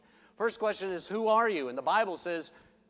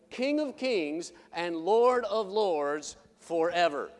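louder, broad proclamation, slowing at the end; proclaiming preaching; 3 semitones higher, 6 dB louder than usual. Acted. Preacher reciting the professor's definition, proclaiming preaching. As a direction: ring it out like a creed.

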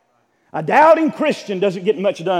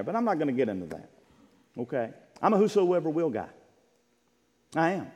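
usual speaking voice

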